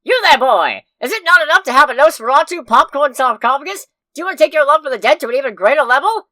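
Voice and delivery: put-on loud old-timey commercial voice; old-timey ad pitchman. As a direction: pitch it like a carnival barker. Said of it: "I have an advertisement to make".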